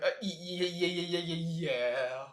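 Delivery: a reluctant, stammered yeah; imitating a nervous agent stuttering. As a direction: stammer out a scared yes. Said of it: "he's like".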